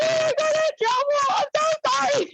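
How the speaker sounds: hammed-up sobbing squeal; mock ugly crying. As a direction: wail it as if barely intelligible. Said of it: "ugly crying".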